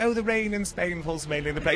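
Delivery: mock British speech-lesson recital; reciting like a British speech coach; British accent. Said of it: "learned how to talk using a British accent".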